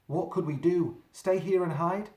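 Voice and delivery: ordinary delivery, nothing marked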